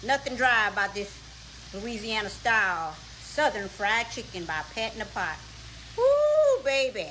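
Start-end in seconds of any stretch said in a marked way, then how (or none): 6.0-6.6 high, loud whoop of delight; excited whoop. Real, not acted. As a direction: belt out a happy whoop. Praising her fried chicken.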